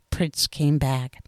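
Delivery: usual speaking voice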